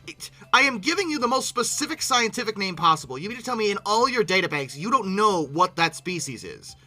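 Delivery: ordinary talk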